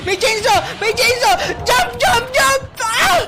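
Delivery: high, urgent bursts; panicked shouting. Real, not acted. Player fleeing a chainsaw and urging jump, high frantic shouts. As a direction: yell each warning in rising panic.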